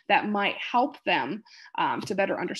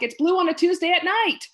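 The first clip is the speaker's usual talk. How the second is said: higher, louder mock yell; retold screaming; 9 semitones higher, 6 dB louder than usual. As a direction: imitate a confused patient shouting nonsense. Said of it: "they're screaming at me".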